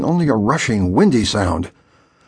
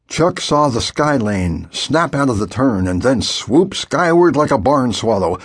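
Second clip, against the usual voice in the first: ordinary delivery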